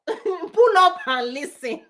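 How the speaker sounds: slow, laughing pull up and listen; laughing call. Real, not acted. Speaker inviting listeners in, slow and laughing. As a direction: draw it out through a laugh.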